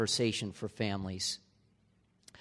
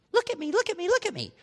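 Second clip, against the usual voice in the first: much higher, needy mock voice of the phone; whiny phone voice; much faster than usual. Pastor voicing a phone begging for attention, high needy whine. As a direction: whine like an attention-starved gadget. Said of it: "I do not want this constantly".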